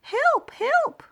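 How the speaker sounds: very high, loud panicked cry; crying for help. A narrator's reading. Old mouse whose pants fell, panicked high cry. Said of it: "cried the old mouse".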